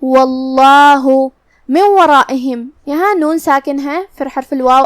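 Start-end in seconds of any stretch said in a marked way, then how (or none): 0.0-2.7 واللہ with a full, heavy lam; heavy Allah. Shown as a demonstration. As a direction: recite واللہ with a thick lam. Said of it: "بھاری آواز میں پڑھنا ہے".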